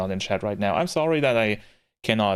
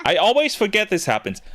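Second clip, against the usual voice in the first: higher, louder surprised outburst; 10 semitones higher, 4 dB louder than usual. Real, not acted. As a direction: blurt it out in surprise. Surprised by game menu.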